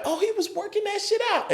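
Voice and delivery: very high-pitched mimicked astonishment; amazed realization. Comics realize Kevin was rehearsing on them, imitated in high amazement. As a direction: act out the penny dropping, voice shooting up.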